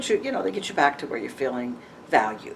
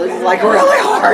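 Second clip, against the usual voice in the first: laughing; 3 semitones higher, 12 dB louder than usual. Real, not acted. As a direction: burst into laughter while stressing how tough it is. Laughing at her own predicament.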